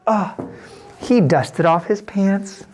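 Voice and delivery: usual speaking voice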